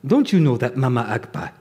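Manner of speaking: mock Nigerian official explaining, half-laughing. Lagos airport official tells the lost boy, in a mock Nigerian accent. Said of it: "not such a stereotypical bad accent".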